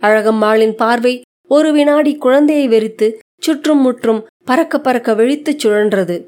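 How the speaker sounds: ordinary delivery, nothing marked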